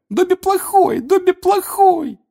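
shrieking high elf chant; very high, loud self-reproach; self-punishing Dobby. A narrator's reading. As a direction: scold yourself loudly as a frantic elf. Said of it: "приговаривая".

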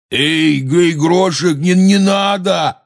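high, loud shout, drawn out at the end; alarmed shout. A narrator's reading. Hagrid stopping the giant bending a tree, shouting. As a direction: yell to stop him. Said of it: "закричал Хагрид".

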